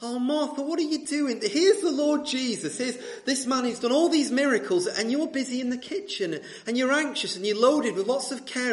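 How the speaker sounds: high-pitched chiding of Martha; mock disapproval. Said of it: "we sort of frown and we say".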